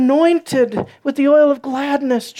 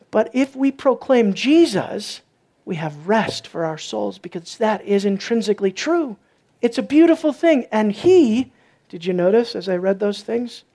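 ordinary talk, nothing marked

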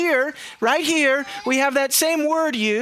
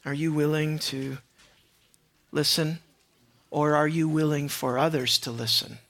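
much softer and lower, searching; hushed appeal; 9 semitones lower, 6 dB quieter than usual. Real, not acted. Preacher asking the congregation if they will listen, hushed and low. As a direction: drop right down and let the pauses sit.

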